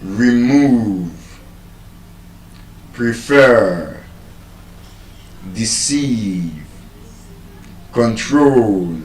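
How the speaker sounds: Remove, prefer, deceive, control, each second syllable stretched; one word at a time, long final syllables in Remove, prefer, deceive, control; stress on second syllable. Shown as a demonstration. Teacher listing example verbs, Remove, prefer, deceive, control stressed on second syllables. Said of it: "it is stressed on the second syllable".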